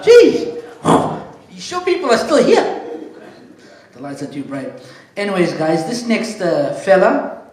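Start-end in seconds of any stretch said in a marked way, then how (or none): 0.0-2.7 loud, high laugh breaking into a joking jab at the crowd; laughing disbelief. Real, not acted. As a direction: laugh it off loudly after the joke flops. Joke bombs, host laughs it off.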